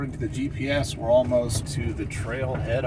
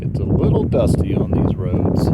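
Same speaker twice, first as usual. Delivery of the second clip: raised voice over wind; 5 semitones lower, 9 dB louder than usual. Real, not acted. Talking over loud wind.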